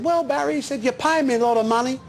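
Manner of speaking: lightly voiced boxer admitting his limits; imitating Bugner. Bugner's last line, retold.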